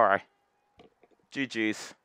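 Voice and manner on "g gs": ordinary talk, nothing marked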